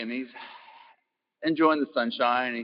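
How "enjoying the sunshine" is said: ordinary delivery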